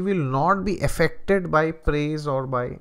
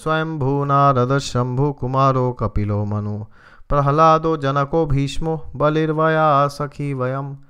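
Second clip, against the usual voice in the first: louder, chanted Sanskrit verse; verse recitation; 6 dB louder than usual. Acted. The preacher quoting Krishna in the Gita, chanted recitation. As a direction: intone the shloka with weight.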